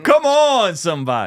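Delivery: loud, high "come on"; excited. Real, not acted. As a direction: hype it up. Excited reaction to clip.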